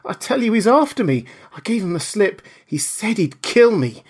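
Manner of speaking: high, loud frightened pleading; begging for shelter in terror; weeping panic. Acted. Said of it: "staggering and weeping".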